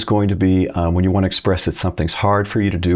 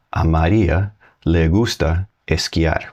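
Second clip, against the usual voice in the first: slow Spanish model; 3 semitones lower, slower than usual. Shown as a demonstration. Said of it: "I would say".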